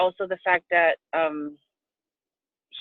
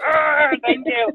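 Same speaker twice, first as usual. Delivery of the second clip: gushing thanks; 7 semitones higher, 4 dB louder, slower than usual. Pretend. Imagined bear hug greeting.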